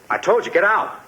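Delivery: sharp, high angry shout to get out; cornered man snapping at the visitor to leave; angry order. Acted. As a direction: drive him out of the house, voice jumping high. Suspect orders cop out.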